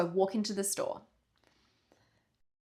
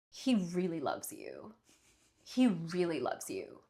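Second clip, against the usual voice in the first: softer, slow and hushed, like a mystic reading; soft slow psychic reassurance; 3 semitones lower, 7 dB quieter, slower than usual. Retold or imitated. Pretend psychic softly telling girlfriend he loves her, hushed and slow. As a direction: lean in and soothe her gently.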